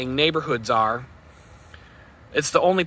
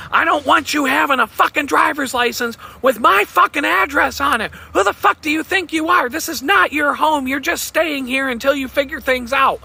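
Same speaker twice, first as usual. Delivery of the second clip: much higher, louder mock-furious yell; ex-girlfriend screaming at him about the address, imitated furious yell; imitating her screaming; 12 semitones higher, 4 dB louder than usual. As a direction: shriek it back as the enraged ex-girlfriend. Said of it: "she's screaming and yelling at me".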